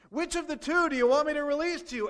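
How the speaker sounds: loud, high governor asking the crowd; voicing Pilate. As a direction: call it out as Pilate. Preacher voices the governor.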